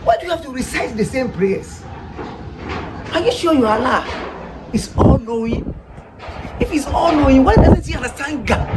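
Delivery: ordinary delivery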